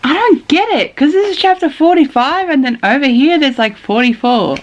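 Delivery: high-pitched, confused complaint; bewildered whine. Real, not acted. Reader baffled by chapter numbers, bewildered high whine. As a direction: whine it high, totally lost.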